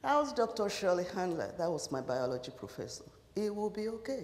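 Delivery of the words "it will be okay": quiet, gentle echo of comfort; soft reassurance. Retold or imitated. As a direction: say it softly like a kind teacher. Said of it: "she said".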